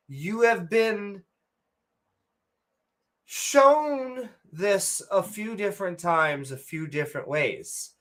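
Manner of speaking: ordinary delivery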